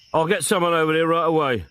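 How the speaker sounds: brisk sergeant on phone. A narrator's reading. Quick, lightly voiced sergeant on the phone. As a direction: sergeant answering a call, quick and businesslike.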